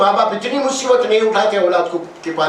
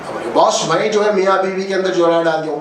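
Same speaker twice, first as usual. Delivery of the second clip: lower, a bit boastful voice; proud minion's answer; 5 semitones lower than usual. Retold or imitated. Minion boasting to Satan about his deed, lightly voiced, lower. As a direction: brag to the boss, pitched low. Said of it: "تو کیا کرا؟".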